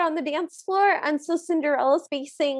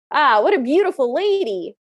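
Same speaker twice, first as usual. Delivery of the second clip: loud, swooping admiration; hammed-up booming compliment with big pitch swings; admiring king; 6 dB louder than usual. King spotting Cinderella on the dance floor, loudly admiring.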